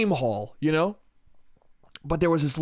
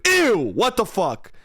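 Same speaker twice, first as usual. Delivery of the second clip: disgusted; 2 semitones higher, slower than usual. Acted. Recoils from own disgusting joke.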